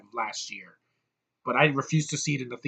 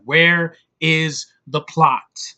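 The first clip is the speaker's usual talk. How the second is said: slow exasperation; 7 dB louder, much slower than usual. Retold or imitated. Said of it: "I'm like".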